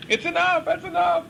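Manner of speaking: high, strained calls of enough; high mock cry. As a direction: squeal it out like it's too much.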